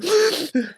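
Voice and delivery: high, shuddering sob breaths; imitated sobbing gasps. Sleep coach showing how babies sob themselves to sleep, imitated sobbing gasps. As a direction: mimic a baby's post-crying gasps. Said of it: "and they're sobbing".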